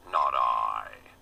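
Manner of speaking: low, loud pig voice refusing; gruff pig. A narrator's reading. The lazy pig refusing the hen, low and gruff.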